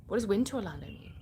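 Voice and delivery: puzzled whisper to oneself; whispered puzzlement. Real, not acted. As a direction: whisper the question, quick and baffled. Puzzling over a viewer's odd comment.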